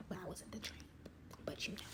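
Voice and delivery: a hushed whisper, tossed off as a joke; whispered aside. Acted. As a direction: drop to a whisper for the cheeky aside. Joking aside under her breath.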